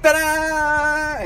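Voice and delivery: high, loud showman reveal; excited ta-da. Real, not acted. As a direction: present it proudly. Second reveal of toy.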